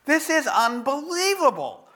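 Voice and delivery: high and stretched-out disbelief; can't believe what just happened; stunned disbelief. Retold or imitated. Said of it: "I'm sitting there thinking".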